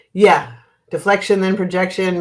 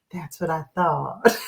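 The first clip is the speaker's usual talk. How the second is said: slow mock Southern drawl; horrible Southern accent; 4 dB quieter, slower than usual. Waitress after the customer rejects sweetener, horrible Southern accent. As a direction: imitate a knowing Southern waitress, unhurried. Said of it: "my horrible accent".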